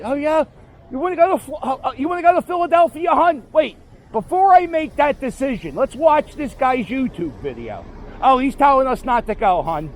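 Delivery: high-pitched, chatty mock tourist; imagined trip-planning couple. Would-be visitor asking partner about Philadelphia, high acted voice.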